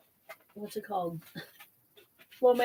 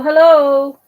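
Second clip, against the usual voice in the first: hello belted out higher; louder, higher hello; 4 semitones higher, 12 dB louder than usual. Real, not acted. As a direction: really call it out. Greeting viewers as stream starts.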